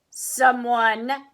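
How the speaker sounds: loud Papa Bear growl; bristling growl. A narrator's reading. Papa Bear in his poofy chair, bristling growl. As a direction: growl it out, affronted. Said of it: "he growled, bristling".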